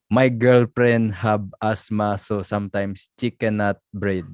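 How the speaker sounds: slow, hammed-up Filipino accent. Host reading out a Dexter meme, mock Filipino accent. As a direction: exaggerate the Filipino accent, p and b swaps. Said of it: "I love your Filipino accent".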